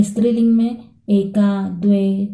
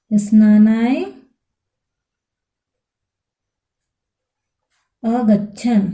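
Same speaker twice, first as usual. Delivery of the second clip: दश बालकाः स्नानाय अगच्छन् dictated one word at a time with long pauses; slower than usual. Shown as a demonstration. Teacher dictating the answer to students, word by word. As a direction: give each word alone, slowly, so students can write it down. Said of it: "तो हम क्या लिखेंगे, हम लिखेंगे".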